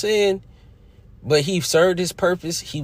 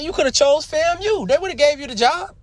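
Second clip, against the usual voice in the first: higher, pleading with Deion; 13 semitones higher than usual. Retold or imitated. Urging Deion to pick FAMU, pleading and raised. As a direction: pitch up and plead to choose FAMU. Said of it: "I'm like".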